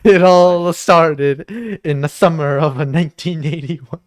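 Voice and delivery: hammed-up opening narration, stretched first words; put-on narrator voice. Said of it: "Maybe not in that voice".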